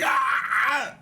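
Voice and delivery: sharp high yelp of pain; sudden hurt yelp; pained cry. Acted. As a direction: cry out as the bolt rubs. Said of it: "And he's like".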